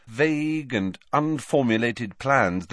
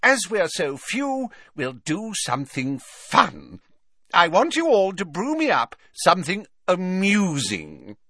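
cheery Slughorn; 11 semitones higher, 4 dB louder than usual. A narrator's reading. Professor setting his small class a fun task, cheery. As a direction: announce a treat with hearty cheer. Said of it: "said Slughorn cheerily".

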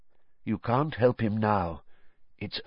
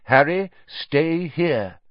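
slow, firm, steady instruction; calm command; 6 semitones higher, slower than usual. Acted. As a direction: keep him in place calmly. Dumbledore instructs firmly.

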